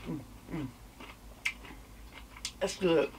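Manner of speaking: talking while chewing food; muffled words between bites; mouth full, chewing. Real, not acted. Seen 0: Bites cucumber at -2, chews while saying mm, that's good.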